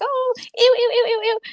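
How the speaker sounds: high, squealed revulsion; hammed-up high squeal of disgust; squeamish disgust. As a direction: recoil from something gross in a high voice. Character recoils from swarming rats.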